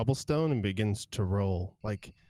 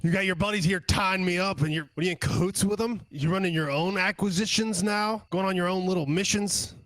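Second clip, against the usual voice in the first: higher, raised, pushy goblin voice; agitated hobgoblin; 8 semitones higher, 4 dB louder, faster than usual. Acted. Hobgoblin grills Fiverr about the stolen ship, higher agitated voice. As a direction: needle him, then backpedal fast.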